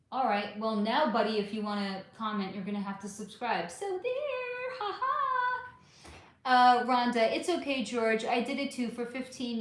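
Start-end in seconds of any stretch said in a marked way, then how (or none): 3.7-5.2 high teasing singsong, much higher; nyah-nyah at the troll, pitched way up; taunting. Real, not acted. Taunting a troll who must subscribe.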